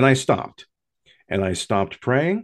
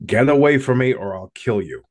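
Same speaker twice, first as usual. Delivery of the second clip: loud, higher mock yell at a threat; retold scream; 5 semitones higher, 6 dB louder than usual. Father yelling at the thing posing as his daughter, loud mock yell. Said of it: "as I screamed at this thing as loud as I could".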